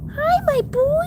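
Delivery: very high coo. Real, not acted. Greeting her pet, very high coo. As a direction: coo hello to your pet.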